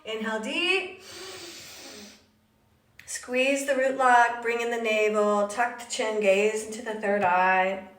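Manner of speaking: low, soft, slow yoga cueing; soft guided meditation. Acted. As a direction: guide the breath calmly and quietly. Soft cueing of a yoga breath lock.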